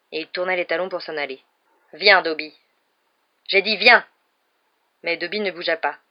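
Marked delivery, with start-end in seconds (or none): 1.9-4.0 loud, impatient order to a servant; loud, insistent master's command; angry command. A narrator's reading. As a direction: order him over, losing patience. Master orders his servant to come.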